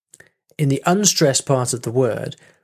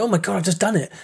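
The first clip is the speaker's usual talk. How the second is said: higher-pitched, amazed retelling; retold excitement; 7 semitones higher, faster than usual. Teacher recalling a first rolled R, retold excitement. Re-enacting the breakthrough moment.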